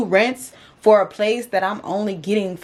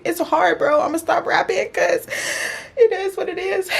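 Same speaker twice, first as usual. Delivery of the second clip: high, tearful resignation; on the verge of crying; 10 semitones higher than usual. Real, not acted. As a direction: cut it short before the tears return. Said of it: "I don't know why it makes me cry".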